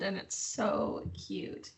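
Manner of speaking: soft, slow whisper over the cat; hushed so the cat stays put; breathy cooing whisper; whispered cuteness. Real, not acted. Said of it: "My cat is hugging my hand".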